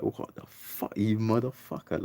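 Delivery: from a whispered gasp up to a high outraged cry; shocked disbelief. Retold or imitated. Seeing the weed-selling policeman with soldiers, shocked. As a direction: catch your breath, then blurt it out high.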